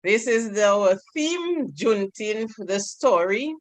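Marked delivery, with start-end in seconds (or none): none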